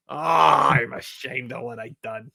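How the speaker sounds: a hint of comic shame; a mild put-on regret; Don Zimmer. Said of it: "Horatio Sanz was Don Zimmer".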